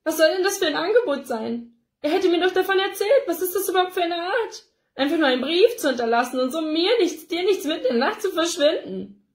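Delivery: a tearful mother demanding answers; upset mother. A narrator's reading. Ella's distressed outburst.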